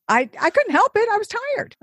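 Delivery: high-pitched, protesting excuse; helpless high-voiced self-defense; defensive excuse. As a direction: plead innocence, pitch jumping up. Excusing herself for yawning.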